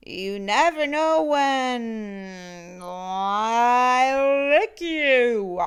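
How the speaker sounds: slow, loud, looming monster threat; mock terrifying monster. A narrator's reading. Monster Mac trying to frighten the child, slow and loud. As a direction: play scary but it's silly. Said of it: "trying to sound terrifying".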